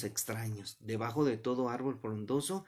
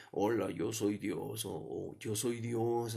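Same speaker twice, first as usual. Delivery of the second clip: slow, slightly lowered booming-God parody; mock voice of God; 2 semitones lower, slower than usual. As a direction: draw it out like a pretend God from above. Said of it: "ni yo tampoco la voy a oír aquí así".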